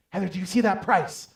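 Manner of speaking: high, loud retold alarm; alarmed objection. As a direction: blurt it in disbelief at your wife.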